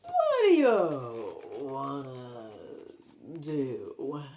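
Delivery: slow, dragged zombie mumble; dazed zombie grandpa asking. Acted. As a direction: drone it out like a blank-eyed zombie.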